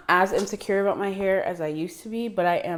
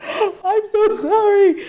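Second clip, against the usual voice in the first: laughing apology; 13 semitones higher, 7 dB louder, much slower than usual. Real, not acted. Laughing apology after failed German.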